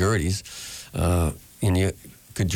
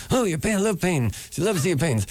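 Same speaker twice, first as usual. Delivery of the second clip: much higher, playful mock young folk singer; 10 semitones higher, faster than usual. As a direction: spin a tall tale in a high put-on voice. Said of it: "And I said".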